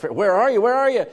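high, loud anxious calling out; calling for lost child. Retold or imitated. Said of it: "And you're yelling".

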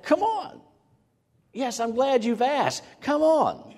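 Jesus cheerfully calling Peter, smiling and beckoning; smiling invitation. Retold or imitated. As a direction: grin and wave him out onto the water. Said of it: "he had a smile on his face".

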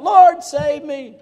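high, loud, slow desperate cry. Retold or imitated. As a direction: call for rescue as you sink. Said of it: "he's crying out".

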